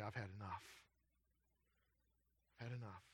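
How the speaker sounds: low, hushed "I've had enough"; quiet finality. Acted. Preacher voicing God at the point of judgment, quiet finality. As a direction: say it softly, as God's final word.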